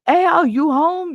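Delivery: high, loud, drawn-out window call. Retold or imitated. Neighbor at the window calling for Al, high drawn-out holler.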